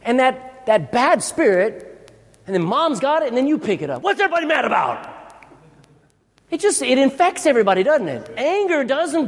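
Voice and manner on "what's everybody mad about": fast, high-pitched mock outburst; irritated parent. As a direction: snap at the whole household, acted out. Acts out parent catching the anger.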